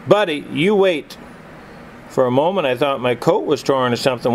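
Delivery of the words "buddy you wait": a firm older-brother order, only lightly voiced; commanding. A narrator's reading. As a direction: tell him to wait, a mild command in a slightly higher voice. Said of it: "he commanded in the old familiar voice".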